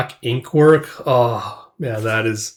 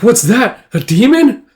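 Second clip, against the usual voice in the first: high, loud alarmed question; acted astonishment at a demon; shocked onlooker; 10 semitones higher, 5 dB louder than usual.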